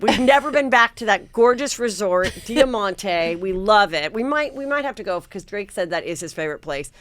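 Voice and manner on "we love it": ordinary talk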